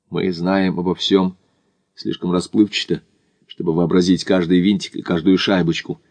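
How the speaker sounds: ordinary delivery, nothing marked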